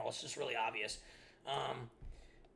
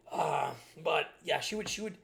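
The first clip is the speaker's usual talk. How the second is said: ordinary talk, nothing marked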